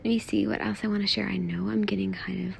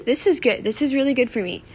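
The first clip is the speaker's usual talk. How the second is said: normal talking voice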